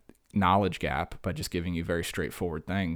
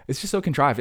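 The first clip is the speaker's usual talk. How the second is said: exasperated mockery; 6 semitones higher, 5 dB louder than usual. Real, not acted. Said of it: "mocking delivery".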